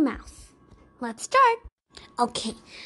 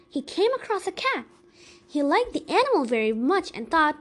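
plain reading voice

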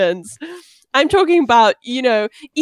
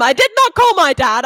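ordinary delivery

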